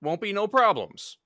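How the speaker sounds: smooth-talking debt collector; Western gambler voice. Acted. Player voices gambler character.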